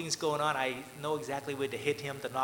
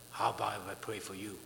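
low, softer imitation of a challenger; mock retort; 7 semitones lower, 6 dB quieter than usual. Irritated man turning the pastor's offer back, mock retort. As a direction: throw it back, low and soft. Retelling a hostile man's taunting retort.

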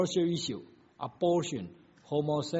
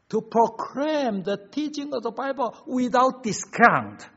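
high, loud, weighty proclamation; full-force preaching; 8 semitones higher, 8 dB louder than usual. Real, not acted. Preacher urges congregation to proclaim Bible teaching, full-force preaching. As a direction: proclaim it to the whole church.

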